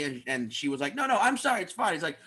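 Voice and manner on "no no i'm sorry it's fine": higher, hurried polite protest; mock apologetic. Audience member reassuring the comedian, higher-pitched mock apology. Retold escalating apology contest, woman's part.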